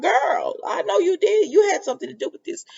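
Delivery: husband rounding on the visitor, high accusing outburst. Retold or imitated. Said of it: "he was just going in on her".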